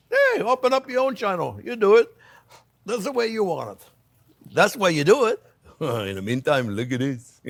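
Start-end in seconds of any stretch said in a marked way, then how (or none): 0.1-3.9 indignant retort. Real, not acted. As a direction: challenge the critics. Retorting to critics.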